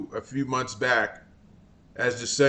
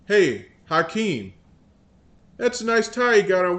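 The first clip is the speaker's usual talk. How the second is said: usual speaking voice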